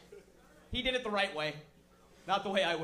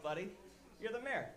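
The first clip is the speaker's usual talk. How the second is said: ordinary talk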